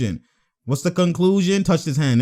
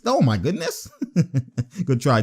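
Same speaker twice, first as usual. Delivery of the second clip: usual speaking voice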